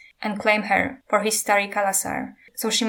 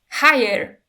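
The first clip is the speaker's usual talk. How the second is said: cried out; 4 semitones higher, 5 dB louder than usual. A narrator's reading.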